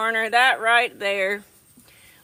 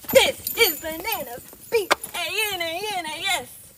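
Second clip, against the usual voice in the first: silly goat voice; 6 semitones higher than usual. Pretend.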